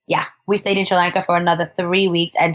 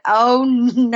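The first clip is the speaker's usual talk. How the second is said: mock dismay; 4 semitones higher than usual. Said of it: "I was like".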